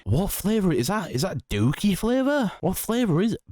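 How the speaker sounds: high, incredulous questions; baffled. Real, not acted. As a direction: disbelief at a strange packet. Baffled by odd flavor.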